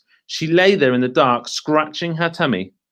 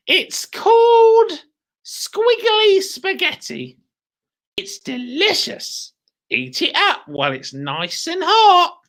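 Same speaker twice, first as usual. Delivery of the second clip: sly crone; 3 dB louder, slower than usual. Acted. Mrs Twit coaxing.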